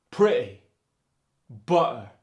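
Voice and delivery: "pretty, butter" loud, glottal New York; New York accent. Shown as a demonstration. Teacher demos New York pretty and butter, loud.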